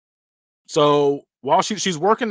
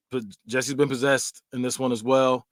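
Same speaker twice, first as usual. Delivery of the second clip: ordinary delivery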